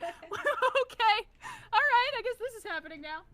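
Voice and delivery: high-pitched amused disbelief; high mock bewilderment. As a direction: squeal it as baffled acceptance. Mock reaction to mismatched voices.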